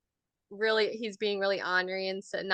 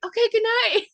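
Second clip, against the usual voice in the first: high, loud, retold call; calling good night; 12 semitones higher, 6 dB louder than usual. Mom calls back from kitchen to toddler, high loud sign-off. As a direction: call it out to end the exchange.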